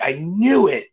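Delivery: KNEW swooping up in pitch; imitated emphatic confirmation; excited realization. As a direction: retell her delighted certainty, leaning on "knew". Retold woman's emphatic repeat.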